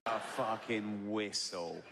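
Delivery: slow, low mock disbelief; incredulous scorn. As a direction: drag it out low, dripping with scorn. Scornful repeat of the announcement.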